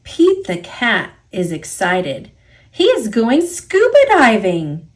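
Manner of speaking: ordinary delivery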